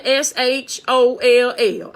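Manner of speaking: S H O L L spelled slowly, letter by letter. Shown as a demonstration. Preacher spelling S H O L L to the crowd, slow and deliberate. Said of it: "Y'all tell these folks that I sure can".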